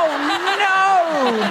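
high "oh, no" through laughter; drawn-out, laughing horror; laughing dismay. Real, not acted. Comic learns tourists expected Shakespeare, laughing dismay.

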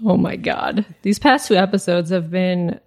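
ordinary delivery

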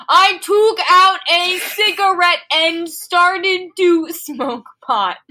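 loud, high, slow all-caps read. Shown as a demonstration. Reader voices the all-caps line, loud and slow. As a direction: belt it out like the text is in caps. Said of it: "this is in all caps".

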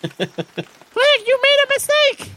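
very high squeaky scolding bat. Acted. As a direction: shriek it like a tiny bat. Summer's Bounty scolds Clegg.

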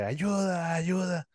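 high, drawn-out cry for help, held back in volume; mock muted scream. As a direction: imitate calling out from a dream. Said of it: "me escuché gritar".

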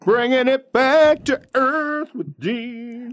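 high, slow announcer call; show announcer voice. Pretend. Mock show announcer intro.

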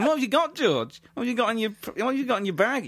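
high, insistent rummaging act; high-pitched hammed-up interrogation; nosy mock questioning. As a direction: pester him in a high voice. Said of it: "looking through 'em going".

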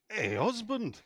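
Yorkshire wife. Acted. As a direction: call to your husband as a Yorkshire wife in a joke. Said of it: "the wife comes out and says to her husband".

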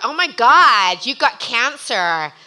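loud, slow, gushing American gasp; gushing shocked supermodel. Retold or imitated. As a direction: overdo the shocked sympathy, slowly. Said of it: "And she's like".

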